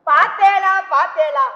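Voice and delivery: loud, thrilled see-see to her husband; proud mother shouting to husband about son's cleverness; excited call. Acted. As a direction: burst in proudly calling him to look. Mother excitedly shows off son.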